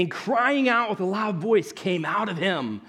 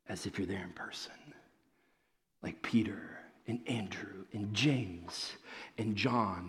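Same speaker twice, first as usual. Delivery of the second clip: much softer, whispered appeal; hushed whisper; 11 semitones lower, 11 dB quieter than usual. Real, not acted. Preacher asks the congregation if they are watching, in a hushed whisper. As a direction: draw the congregation in close.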